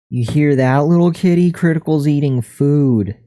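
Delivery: high sing-song pet voice; cooing at the kitty. Real, not acted. Talking to the cat.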